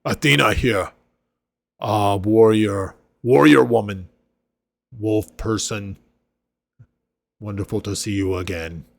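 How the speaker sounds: half-orc hailing his companions by name; hearty half-orc greeting. Acted. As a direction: greet old friends like a big friendly brute. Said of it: "he looks around at everyone and he says".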